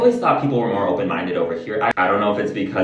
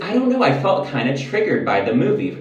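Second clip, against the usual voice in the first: normal talking voice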